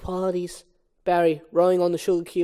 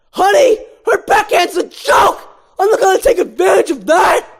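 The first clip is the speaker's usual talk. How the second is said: high loud mock outrage; Ken ranting; 11 semitones higher, 10 dB louder than usual. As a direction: shout it like an indignant jock. Ken character yelling in script.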